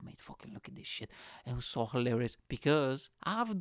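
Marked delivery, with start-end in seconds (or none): 0.0-1.1 hushed whisper to a mate; soft conspiratorial whisper to his comedy mates; whispered aside. Retold or imitated. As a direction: whisper it so she can't hear. Nudging comedy mates at the gig.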